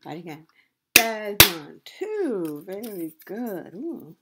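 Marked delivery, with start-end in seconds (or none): 0.9-1.8 Desmond in two loud beats, Des-mond; syllable by syllable. Shown as a demonstration. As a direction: punch out both syllables of Desmond. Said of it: "how many parts a word can be broken into".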